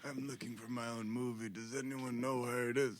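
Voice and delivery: put-on frail old man voice. Frail Dustin Hoffman impression.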